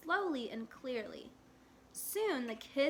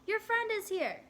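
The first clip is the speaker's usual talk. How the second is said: calling up; 9 semitones higher, 4 dB louder than usual. A narrator's reading. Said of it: "Mother called up to Yoon-hae".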